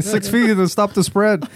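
slow, deliberate public-service slogan; mock PSA read, slowed down. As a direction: recite it like a health announcement. Quoting pandemic slogan.

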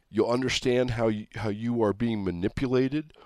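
usual speaking voice

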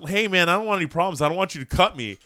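nervous guy trying to avoid trouble; alarmed bar patron. Retold or imitated. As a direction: imitate a guy anxiously backing down. Retold plea of alarmed bar patron.

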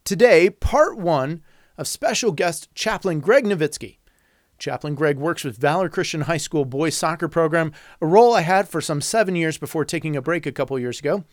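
upbeat host intro read; podcast intro. Acted. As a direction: announce the show with energy.

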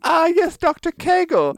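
very high, hammed-up British voice; mock British accent. Crick recognises Watson's doctor, in a high mock British accent.

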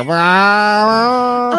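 long high pretend "aaah"; mock drugged wail. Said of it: "And be like".

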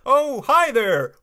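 high, hearty put-on hello; character greeting. Retrying the character's greeting, high put-on voice.

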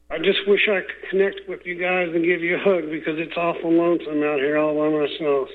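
ordinary talk, nothing marked

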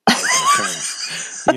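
a high laughing cry of giving in; laughing give-in. Retold or imitated. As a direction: crack up and give in to the hug. Giving in to son's hug.

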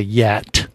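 "yacht" said as "yet", with a short e; odd pronunciation. Real, not acted. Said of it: "that's how they say it in Egypt".

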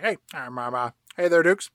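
halting, offhand mumbler full of ums; casual impression of a disengaged boss; Burt Reynolds. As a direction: make threats haltingly, as if barely bothering. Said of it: "And Boss Burt Reynolds says".

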